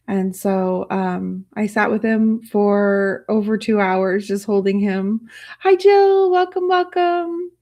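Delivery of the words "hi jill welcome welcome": much higher, louder happy welcome; light up to greet a new viewer; bright greeting. Real, not acted. Streamer greets Jill arriving in chat, cheerful and high.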